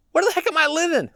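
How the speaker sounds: high-pitched baffled outcry; mock bewilderment. Colonist finding a war around them, mock bewildered. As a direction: exclaim it high, comically at a loss.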